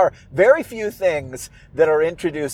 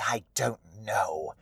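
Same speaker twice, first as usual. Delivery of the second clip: put-on theatrical old widow, disdainful; Old Widow Holcomb. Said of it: "Holcomb says".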